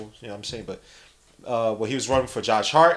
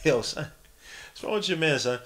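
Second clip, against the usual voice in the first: normal talking voice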